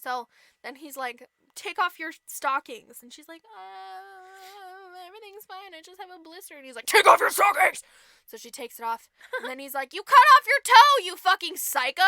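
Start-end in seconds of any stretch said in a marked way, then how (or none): 3.4-6.5 small, high, very soft voice; nervous cover-up. Retold or imitated. Stepsister hides bloody foot from prince, meekly high. 6.9-7.8 loud, high, insistent command; mock angry shout. Prince demands to see her foot, shouting. 9.9-12.0 high, loud yell dropping off; mock horrified outrage. Prince discovers severed toe, yelling in outrage.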